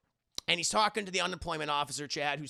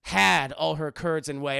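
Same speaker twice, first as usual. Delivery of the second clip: mock bad poetry; 4 dB louder than usual. The poet reciting bad verse to the café. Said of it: "shitty version of poetry".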